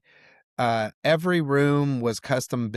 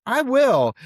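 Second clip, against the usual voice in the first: eager reply; 11 semitones higher, 5 dB louder than usual. Retold or imitated. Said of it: "And I was like".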